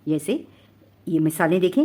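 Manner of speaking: ordinary talk, nothing marked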